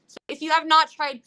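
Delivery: ordinary delivery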